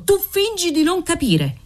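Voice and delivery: tu fingi di non capire peaks in pitch, stress on tu; high accusing cry. Acted. Father's climax accusation.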